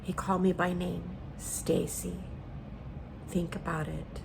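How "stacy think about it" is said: hushed, half-whispered gentle prompting; voice of the Spirit. Retold or imitated. The Spirit telling her to reconsider, hushed imitated voice. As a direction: imitate a spirit speaking softly. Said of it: "the Spirit spoke to me".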